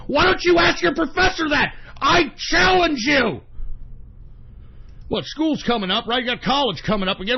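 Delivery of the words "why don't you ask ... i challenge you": loud challenge. Real, not acted. Challenging students loudly.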